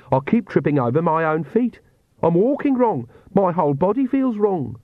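high-pitched character grumble; complaining Agnes. Acted. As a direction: complain in dismay about your own body.

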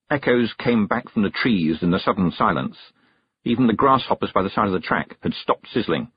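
usual speaking voice

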